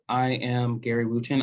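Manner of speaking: normal talking voice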